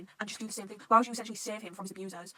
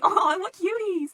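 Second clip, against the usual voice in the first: high, loud, drawn-out cooing; adoring squeal; 11 semitones higher, 10 dB louder, much slower than usual. Acted. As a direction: gush over something adorable. Gushing over cute pair.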